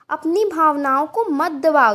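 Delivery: usual speaking voice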